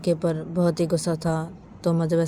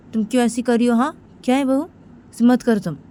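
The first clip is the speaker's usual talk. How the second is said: acted scolding; 6 semitones higher, 4 dB louder than usual. Daughter-in-law being scolded, acted.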